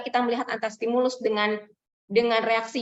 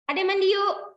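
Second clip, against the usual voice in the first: mother coaxing the child to bathe, voiced much higher; sweetly inviting the little one into the bath, pitched up; 9 semitones higher, slower than usual. Mother coaxing toddler to bathe, high and sing-song.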